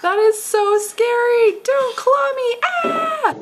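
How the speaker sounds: very high, loud playful shrieks; play-scared for a child; frightened prey animal. Pretend. Pretend fear of clawing dinosaur.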